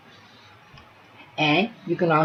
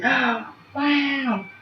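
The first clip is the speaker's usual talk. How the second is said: reassuring; 8 semitones higher than usual. Shown as a demonstration. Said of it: "you can say".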